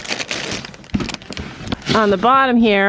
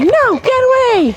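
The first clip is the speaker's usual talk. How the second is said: high, louder protesting goat voice; voicing the goat; 4 dB louder than usual. Goat voiced fending off the pig, high and loud. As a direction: push him off in a high squeal. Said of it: "Poor Winston's like".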